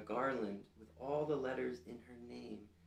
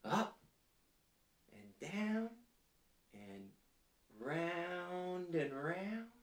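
voice follows the ladybug; 6 semitones higher, much slower than usual. Shown as a demonstration. Teacher to children, miming the ladybug's flight with a swooping voice. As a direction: pitch swooping higher up and down, slowed on round and round. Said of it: "Can you make it go".

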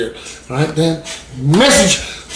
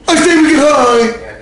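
high-pitched, drawn-out maniac cry; slow, high voice of a crazed urge; maniac inner voice; 8 semitones higher than usual. Acted. Maniac in his head voting to use, manic. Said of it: "that maniac up in my dome keeps telling me".

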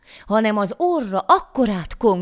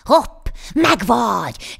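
loud, slow, raised catch cry; triumphant cry; 4 semitones higher, 6 dB louder, much slower than usual. A narrator's reading. Devil king catches the poor man, crying out. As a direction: pounce with a drawn-out shout. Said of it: "kiáltott az ördögök királya".

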